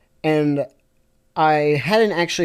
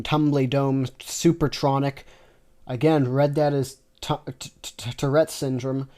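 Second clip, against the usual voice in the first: ordinary delivery, nothing marked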